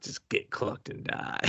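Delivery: soft, unvoiced slow whisper; whispered trailer line; whispered tagline. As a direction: breathe it like a menacing teaser. Tagline muttered as joke.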